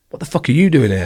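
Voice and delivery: put-on old instructor spotting him in the mess, surprised; surprised greeting. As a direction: blurt it out at an old face. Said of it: "he was kind of back turned and was like".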